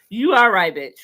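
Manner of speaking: ordinary delivery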